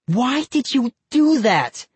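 high, slow, drawn-out demand; upset accusation. A narrator's reading. Girl confronts cousin who broke her doll, upset. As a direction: demand an answer from the culprit.

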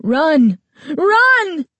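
very high, loud frantic yell; frantic cry. A narrator's reading. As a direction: scream for her to flee. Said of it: "his frantic cry".

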